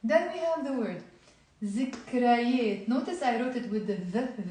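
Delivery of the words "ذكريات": ذكريات starting with a Z sound; Lebanese Z. Shown as a demonstration. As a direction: Lebanese zikrayet, Z in place of th. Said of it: "I'm pronouncing it as a Z".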